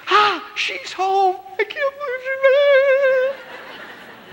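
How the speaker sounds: high, slow, delighted and laughing; a husband swooning with joy, high and laughing; thrilled husband. Retold or imitated. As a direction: gush like a man overjoyed his wife is home. Husband thrilled his wife is home.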